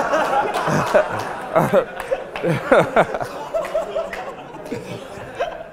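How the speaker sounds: long high laugh, breaking up; big laugh. Real, not acted. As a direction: crack up at your own line. Laughing at his own joke.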